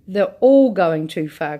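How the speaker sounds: put-on boy crying out in alarm. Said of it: "cried Charlie".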